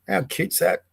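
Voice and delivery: ordinary talk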